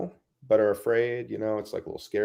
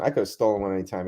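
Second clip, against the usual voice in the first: normal talking voice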